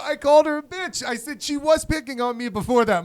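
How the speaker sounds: high, loud hammed-up confession; panicked mock yell. Kid repeating confession to shocked mom, high mock yell. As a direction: blurt it out in a squealing yell.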